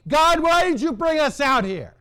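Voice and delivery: preacher voicing the people's angry grumbling in the desert; angry complaining. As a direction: rail at them, sick and tired of it all. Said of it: "So they're grumbling and they're complaining".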